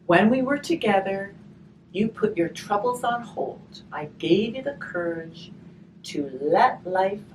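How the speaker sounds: gentle storybook reading, slow on the rhymes; reading to children. Acted. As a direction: read the rhyme aloud to the children. Reading a rhymed picture book aloud.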